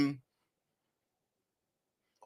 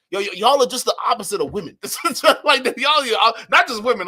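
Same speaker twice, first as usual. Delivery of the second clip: laughing; 5 semitones higher, 7 dB louder than usual. Real, not acted. Laughing rant.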